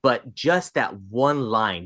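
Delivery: ordinary talk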